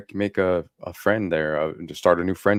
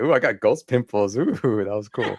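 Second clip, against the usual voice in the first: retold excitement; 4 semitones higher, 4 dB louder than usual. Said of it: "it got me all excited".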